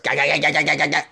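rapid ga-ga-ga gun noise; cartoon gunfire noise; mock gunfire. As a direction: spit out machine-gun sounds. Imitated gunfire.

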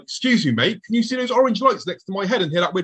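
bewildered plea. Retold or imitated. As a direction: act out asking a stranger for help. Acted plea to a passerby.

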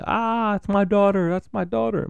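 high, excited yell from the stands; proud yelling. Retold or imitated. Dad yelling from the stands at his daughter's game, excited high cheer. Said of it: "like, yelling".

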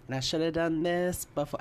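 ordinary delivery

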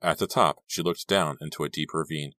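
plain reading voice

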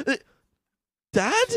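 high, stunned Dad?; shocked son. Retold or imitated. Son finding his dad underwater, shocked. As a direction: call out in disbelief. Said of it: "And he's like".

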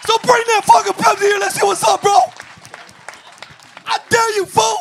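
high, loud playful taunt; mock tough shout. Taunting fans to bring a Pepsi, mock shouting. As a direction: yell a fake threat, grinning.

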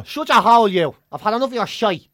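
loud, mock-Irish student back home; put-on Irish accent. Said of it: "put on the same accents when".

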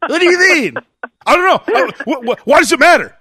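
high, loud, defensive mock yelling; touchy Sam. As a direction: imitate someone with a very short fuse. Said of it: "Here, here, you be Sam".

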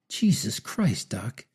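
put-on narrated shock at seeing an old friend; amazed. A narrator's reading. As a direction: gasp it out in disbelief. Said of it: "said the amazed time traveler".

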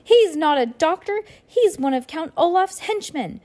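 high, urgent exclamation; shouting. A narrator's reading. Violet exposing the doctor as Olaf's henchman, high shout. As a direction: call out the accusation high and urgent. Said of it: "Violet shouted".

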